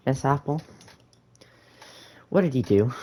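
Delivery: imitated indignant schoolgirl question; retold challenge to teacher. Classmate challenges teacher in retold scene.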